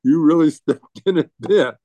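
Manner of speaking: laughing at himself mid-line; rueful laugh. Real, not acted. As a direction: chuckle through the admission of a blunder. Laughing at own blunder.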